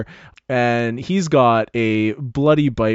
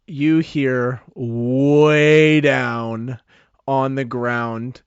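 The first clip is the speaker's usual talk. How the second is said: usual speaking voice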